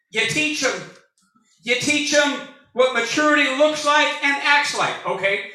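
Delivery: usual speaking voice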